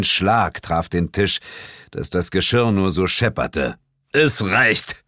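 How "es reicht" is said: a mildly thundered command; the boss cuts the quarrel short; booming rebuke, understated; thundering. A narrator's reading. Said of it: "donnerte Sir James".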